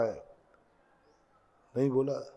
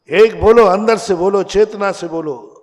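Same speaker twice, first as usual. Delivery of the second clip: loud, raised urging to the crowd; rousing call; 5 semitones higher, 7 dB louder than usual. Real, not acted. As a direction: call out for a wholehearted response. Preacher urging crowd to answer together.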